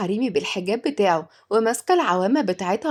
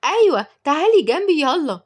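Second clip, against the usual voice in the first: put-on high, louder child's eager yes; excited; 8 semitones higher, 5 dB louder than usual. A narrator's reading. Little girl inviting the nanny over, excited and high. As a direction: jump at the offer like a little girl. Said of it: "ردت تيا بحماس".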